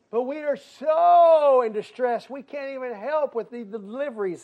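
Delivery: loud, high cry with a long drawn SO; distressed plea. Acted. Hezekiah's message to Isaiah, loud acted distress. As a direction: wail it out, slow and hammed-up.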